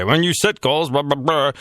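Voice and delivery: a hint of a pep-talk speaker, dismissive; mock motivational speaker. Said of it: "something a motivational speaker would say".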